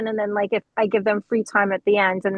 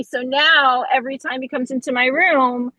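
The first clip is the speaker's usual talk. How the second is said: usual speaking voice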